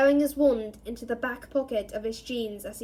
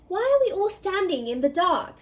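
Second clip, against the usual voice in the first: higher, brisk woman's voice; young woman's voice; 7 semitones higher, 3 dB louder than usual. Acted. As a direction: lift it into a lively young woman. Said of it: "a woman's".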